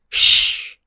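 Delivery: long loud hissing shush; stern classroom shush; teacher's shush. A narrator's reading. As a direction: silence thirty teenagers at once. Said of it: "alsof ik les gaf".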